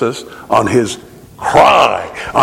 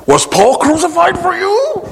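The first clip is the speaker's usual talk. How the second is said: high challenge. Acted. Preacher challenging the congregation.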